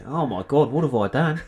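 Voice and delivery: put-on horrified clone in a Kiwi accent. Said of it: "in a Kiwi accent".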